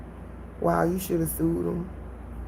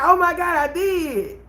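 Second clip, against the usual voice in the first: much higher, louder gasp into a laugh; shocked realization; 9 semitones higher, 4 dB louder, slower than usual. Retold or imitated. Realizing on the phone she did, higher and laughing. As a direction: caught out, half laughing. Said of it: "I'm like".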